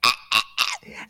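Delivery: unvoiced wheezing gasps; choking gasps. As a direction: gasp like you can't breathe.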